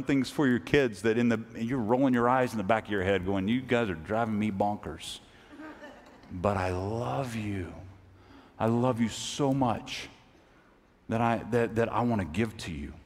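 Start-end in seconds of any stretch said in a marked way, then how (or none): 3.5-12.9 tired loving parent. Retold or imitated. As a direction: sigh it out to the kids. Weary parent to kids.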